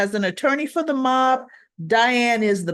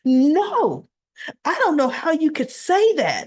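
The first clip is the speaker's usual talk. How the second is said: indignant denial; 5 semitones higher than usual. Retold or imitated. Retold indignant denial in an argument.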